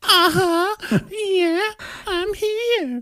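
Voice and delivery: very high squeaky mock reply; tiny squeaky voice from the box; trapped wife. Wife answers her husband from the box, squeaky high. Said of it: "She's like".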